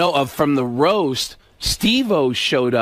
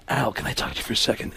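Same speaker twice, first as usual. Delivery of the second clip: low, soft, quick request; Alec Baldwin; 6 semitones lower, 8 dB quieter, faster than usual. Retold or imitated. Actor asking his rival for a word, hushed impression. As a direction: ask discreetly, voice dropped. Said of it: "and Alec goes".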